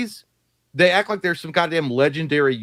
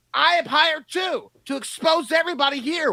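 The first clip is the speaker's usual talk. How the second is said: mock shouted retort; 13 semitones higher than usual. Mock kid yelling back at parent.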